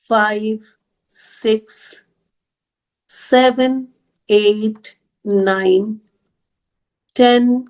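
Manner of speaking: each number on its own, long pauses between; deliberate, evenly spaced count; slow counting. Real, not acted. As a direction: count out slowly while numbering boxes. Numbering boxes one by one aloud.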